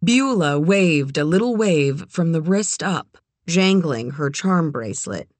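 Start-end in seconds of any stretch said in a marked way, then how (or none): none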